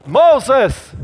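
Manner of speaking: high, loud, drawn-out call. Retold or imitated. God calls Moses from the bush, calling out. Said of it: "rief er ihn, äh, ihn aus dem Busch heraus".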